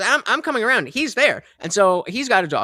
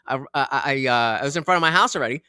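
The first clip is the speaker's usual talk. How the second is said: ordinary talk, nothing marked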